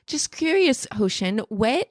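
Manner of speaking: usual speaking voice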